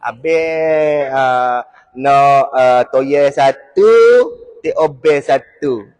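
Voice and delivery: slow, low, drawn-out server voice; tomyam waitress. Acted. Waitress repeats a man's order at tomyam shop, slow and coaxing. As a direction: linger sweetly over each item of the order. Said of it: "lelaki suka pergi kedai toyam".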